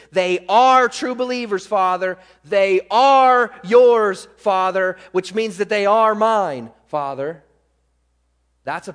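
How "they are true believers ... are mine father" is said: louder declaration, "are" punched and stretched; forceful preached prayer. Acted. Preacher voices Jesus pleading for believers, forceful preaching. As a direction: press each claim home, hitting "are".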